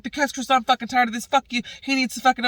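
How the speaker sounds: high, rushed angry retort; heated comeback. Retold or imitated. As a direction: throw it back, fed up. Voices Stella's side of row.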